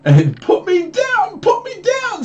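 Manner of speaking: very high, loud, slow squeal; squeaky fish protest. Acted. Fish being held up, squealing high to be put down. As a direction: wail it like a fish dangling in the air.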